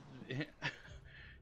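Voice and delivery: quick airy laugh; soft unvoiced chuckle; breathy laugh. Real, not acted. Laughing at Dark Vader.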